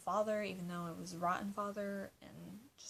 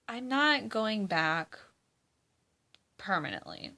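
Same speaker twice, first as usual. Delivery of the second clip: emphatic; 7 dB louder than usual. Real, not acted. Firm answer to fans.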